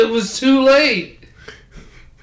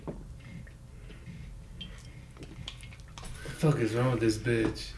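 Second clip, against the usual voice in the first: low, much softer muttered curse; under his breath; 11 semitones lower, 18 dB quieter, slower than usual. Retold or imitated. Retelling his own shock to his friend, low and much softer. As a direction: curse it quietly to yourself. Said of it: "I was just like".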